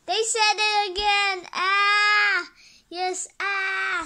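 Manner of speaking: high, loud thrilled shouting; excited cheer. Acted. Fan cheering the superhero, loud and high. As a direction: yell it out with glee.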